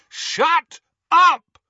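loud, high-pitched roar; bellow. A narrator's reading. Harry to the screaming portrait, furious bellow. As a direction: bellow each word hard. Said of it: "Harry bellowed".

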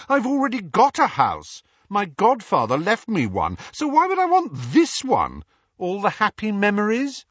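Harry retorting to uncle; sarcastic retort. A narrator's reading. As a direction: land the argument sarcastically.

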